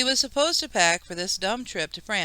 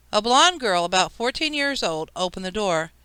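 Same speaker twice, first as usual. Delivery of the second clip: ordinary delivery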